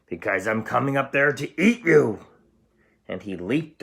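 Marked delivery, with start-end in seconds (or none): 0.1-2.2 troll roar. Acted. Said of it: "roared the troll".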